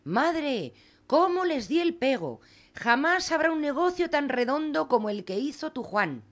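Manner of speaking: put-on boastful boy Juan. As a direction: brag to mother like a cocky kid.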